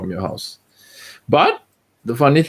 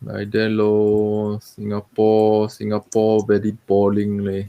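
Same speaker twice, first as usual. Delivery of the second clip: drawled Singlish with lah and lor; laid-back Singapore Creole English. Acted. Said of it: "We will talk in Singlish".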